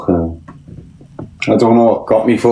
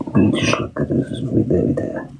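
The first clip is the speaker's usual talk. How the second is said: whispered aside; 6 dB quieter than usual. Real, not acted. Muttering while inspecting himself.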